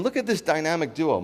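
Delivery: usual speaking voice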